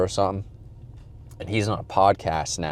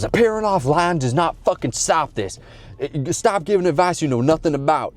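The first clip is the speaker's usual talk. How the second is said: imitated angry rant; 8 semitones higher than usual. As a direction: snap it at the chat like a fed-up streamer. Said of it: "And he goes".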